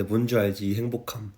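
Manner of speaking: normal talking voice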